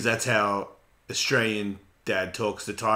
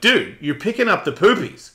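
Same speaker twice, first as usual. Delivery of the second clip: stern dad; 7 semitones higher, 6 dB louder than usual. Retold or imitated. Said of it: "And I'm like".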